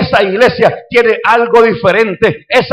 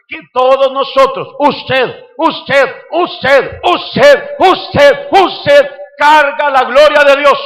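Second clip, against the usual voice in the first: rising from firm to loud, slow final line; full force preacher's climax; building preaching shout; 4 semitones higher, 5 dB louder, much slower than usual. Acted. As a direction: point at each person, louder each time.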